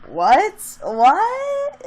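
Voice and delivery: high, loud shocked "What?"; stunned outrage. Real, not acted. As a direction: throw "What?" up high in disbelief. Outraged disbelief.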